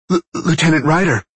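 nervous stammer, high and loud; stuttering. A narrator's reading. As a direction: trip over the first sound, flustered. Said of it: "he stuttered".

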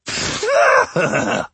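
high, bleating goat laugh; goat cackle. Acted.

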